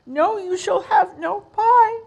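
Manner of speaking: high, loud crying scold; scolding mother cat. Acted. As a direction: shout it like a cross mother cat.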